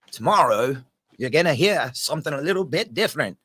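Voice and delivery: put-on host trying out an Australian accent for viewers; attempted Australian accent. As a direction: tease the Aussie guests with a rough Australian accent. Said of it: "is that a good Australia accent?".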